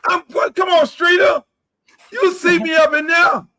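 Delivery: loud, high, hyped-up calls; fired-up yelling at the screen; excited hollering. Real, not acted. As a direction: shout with glee at seeing yourself. Hyped at seeing himself on screen.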